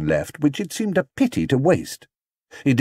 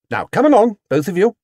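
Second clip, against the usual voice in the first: higher, louder mother's call to hurry; brisk Mrs Brown; 10 semitones higher, 5 dB louder than usual. Acted. Mrs Brown calling Paddington and Judy along, brisk. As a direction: hurry the children out.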